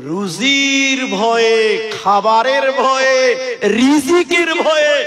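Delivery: preacher driving the point home phrase by phrase; slow emphatic preaching. Acted. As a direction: drag out every ভয়ে for weight. Preacher hammering a list.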